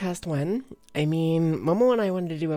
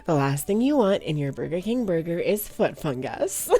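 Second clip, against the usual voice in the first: ordinary talk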